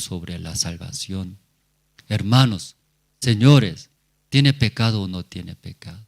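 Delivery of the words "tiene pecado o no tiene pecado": ordinary talk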